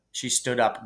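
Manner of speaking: usual speaking voice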